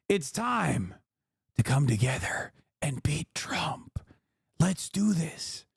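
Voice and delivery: trailing off into a slow, soft breathy whisper; mock Biden whispering the rallying line, slowing down; hushed, faltering old-man whisper; Biden fading to a whisper. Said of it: "Joe Biden said".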